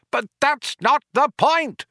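acted high-pitched rage; raging. A narrator's reading. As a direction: protest furiously, pitched right up. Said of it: "raged Ford".

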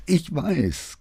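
high-pitched whine; whining. A narrator's reading. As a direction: whimper it like a scolded subordinate. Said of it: "greinte der junge Mann".

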